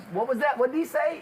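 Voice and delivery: put-on very high-pitched old lady voice; hard-of-hearing old lady. Old wife asks what cop said, very high voice. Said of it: "The old lady don't hear real good".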